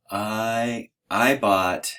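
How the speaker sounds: halting, drawn-out admission; slow, sheepish husband owning up; hesitant confession. Acted. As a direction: stall between words, reluctant to confess. Acts out confessing a purchase to wife.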